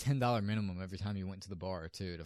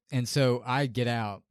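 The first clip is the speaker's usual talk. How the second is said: ordinary talk, nothing marked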